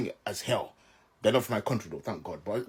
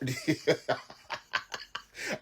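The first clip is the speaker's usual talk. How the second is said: breathy laugh; 3 semitones higher, 6 dB quieter than usual. Real, not acted. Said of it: "I'm sorry, I'm laughing".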